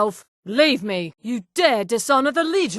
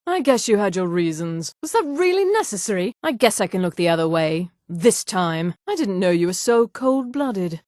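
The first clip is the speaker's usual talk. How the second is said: ordinary talk, nothing marked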